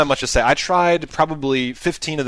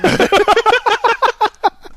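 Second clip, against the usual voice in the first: high squealing laugh; 4 dB louder than usual. Real, not acted. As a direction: burst of shrill laughter. Big laugh at joke.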